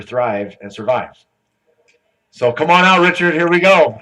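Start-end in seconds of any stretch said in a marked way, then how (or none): 2.3-4.0 loud, raised stage introduction; calling up a speaker. Real, not acted. Host bringing the next speaker on stage, loud calling out. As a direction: announce it big and higher, to the whole hall.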